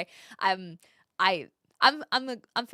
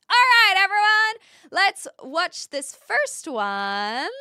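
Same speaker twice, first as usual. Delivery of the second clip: announcer call; 9 semitones higher, 10 dB louder, slower than usual. Real, not acted. Announcing the first video.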